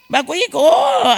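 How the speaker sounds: slow words with a real sob in them; voice breaking. Real, not acted. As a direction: choke up at the memory. Cry heard while retelling. (suara tercekat menangis)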